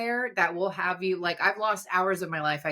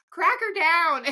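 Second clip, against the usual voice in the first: quoting a movie line in a much higher mock voice; mock singer's voice; 11 semitones higher, 4 dB louder, slower than usual. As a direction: squeak it out much higher, like the guy in the film. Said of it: "you just hear this voice go".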